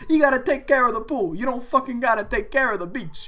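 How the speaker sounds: high, loud mock rant; quoting the coworker. Telling friends his coworker's beach line, high and loud. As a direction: repeat his line with gusto. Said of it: "And I'm like".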